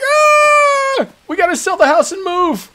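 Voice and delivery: very high, loud panicked shriek; mock panic. Wife panicking about the spider to her husband, very high shriek. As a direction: shriek it like you're fleeing.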